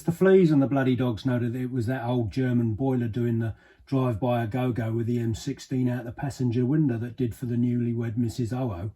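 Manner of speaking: plain reading voice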